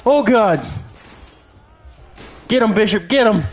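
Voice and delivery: high frantic shouting; panicking gamer. Real, not acted. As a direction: urge your ally on in panic. Explosions in fight.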